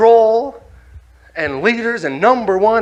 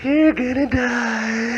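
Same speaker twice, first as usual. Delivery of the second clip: ominous inner thought; 2 semitones higher, 6 dB quieter, much slower than usual. Retold or imitated. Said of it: "And I was thinking".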